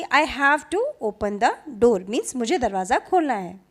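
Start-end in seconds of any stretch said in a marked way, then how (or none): 0.0-2.1 each word spelled out very slowly; slow, deliberate model sentence; slow teaching demo. Shown as a demonstration. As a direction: say it slowly for a child to copy. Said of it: "तो वो समझ जाएगा कि".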